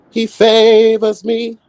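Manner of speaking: high, slow and grandiose; lofty drawn-out diva boast; grand diva voice. Pretend. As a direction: proclaim it like a chosen diva. Diva praising herself to God.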